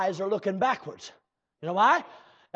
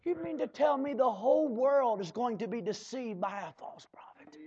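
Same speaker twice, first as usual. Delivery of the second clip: hushed, breathy whisper, softer; whispered question; 5 dB quieter than usual. Acted. Preacher leaning in to his flock, whispered disbelief. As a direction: ask it in a conspiratorial whisper.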